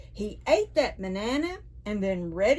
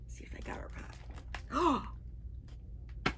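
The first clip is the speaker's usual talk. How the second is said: whispered aside; 4 semitones higher, 14 dB quieter, much faster than usual. Real, not acted. Storyteller whispering to the children, hushed. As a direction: lean in and whisper.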